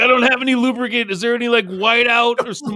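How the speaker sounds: hammed-up desperate request; mock frantic request; high panicky asking. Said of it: "He's like".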